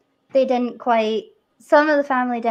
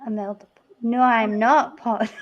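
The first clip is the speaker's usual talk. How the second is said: ordinary talk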